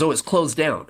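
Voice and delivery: the agent breaks the bad news; dismayed agent. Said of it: "He goes".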